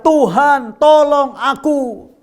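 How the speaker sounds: high, drawn-out cry for help. Acted. Sinking Peter calling to the Lord for rescue, high drawn-out cry. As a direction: stretch the plea out, pitched well up. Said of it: "lalu berteriak".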